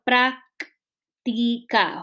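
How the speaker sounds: práctica very slowly as prác, ti, ca; syllable by syllable. Shown as a demonstration. Teacher dictating the separation for notebooks, syllable by syllable. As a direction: stretch práctica out, pausing after each syllable. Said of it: "para separarlo".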